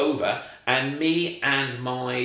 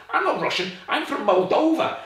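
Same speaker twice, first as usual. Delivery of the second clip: higher-pitched imitation of an Eastern European twang; Eastern European accent; 12 semitones higher, 4 dB louder than usual. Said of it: "and a twang".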